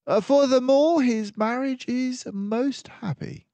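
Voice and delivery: a composed mouse detective taking charge; Basil the detective. Acted. Basil adds a deduction.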